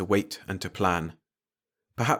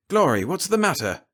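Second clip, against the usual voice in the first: alarmed; 9 semitones higher, 4 dB louder than usual. Acted. Father alarmed by sobbing daughter.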